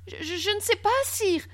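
high, nervous voice; frightened stammer. A narrator's reading. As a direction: stutter helplessly before the angry king. Frightened boy stammers to king. (bégaiement apeuré, voix aiguë)

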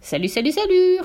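rising bird greeting; parrot voice. A narrator's reading. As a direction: parrot call leaping high at the end. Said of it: "reprit la voix".

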